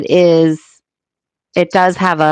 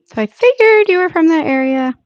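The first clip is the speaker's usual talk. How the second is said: playful high voice; 11 semitones higher than usual. Real, not acted. Playful remark to chat, very high.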